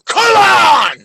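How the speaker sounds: loud, high burst of disbelief; frustrated shout at a troll; exasperated shout. Real, not acted. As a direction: yell it at him, fed up. Exasperated at debate opponent.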